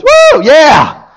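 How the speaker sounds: loud high whoop of joy; whooping teen. Retold or imitated. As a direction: cheer like a reckless kid running a light. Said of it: "he goes".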